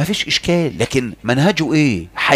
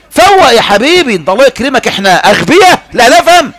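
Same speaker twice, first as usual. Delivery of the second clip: sarcastic mockery; 8 semitones higher, 6 dB louder than usual. Mocking opponents' claim of ignorance.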